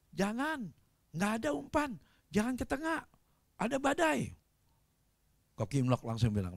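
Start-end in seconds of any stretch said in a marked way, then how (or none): 0.1-4.3 retold cautionary plea; urgent warning. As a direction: warn them off, pitched high. Acted warning from the fishermen.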